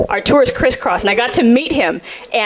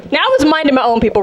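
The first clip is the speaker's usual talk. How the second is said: ordinary talk, nothing marked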